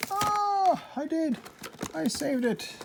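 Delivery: high, triumphant exclamation; real excited relief; delighted relief. Real, not acted. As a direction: burst out high with happy surprise. Delighted at saving the packaging.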